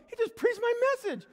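very high, loud protest; acted dismay. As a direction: wail it in mock outrage. Old preacher aghast at stolen message.

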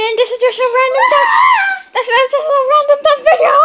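fast, loud and high; excited shouting. Real, not acted. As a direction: blurt it out giddy. Excited kid shouting in vlog.

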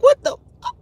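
high-pitched mock disbelief; retold shock. Host re-enacting her first reaction to the news, shocked. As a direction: squeal it as a re-enacted double take.

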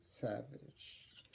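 breathy hushed title drop; dramatic whisper. Acted. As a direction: whisper the name like a trailer.

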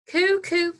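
high, singsong coos; pigeons cooing. A narrator's reading. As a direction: coo like a row of pigeons. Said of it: "sang the pigeons".

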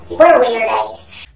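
loud drawn-out exclaim. Real, not acted. Telling viewers about late-night ghost videos, loud and drawn out. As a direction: shout it out, savouring the silliness.

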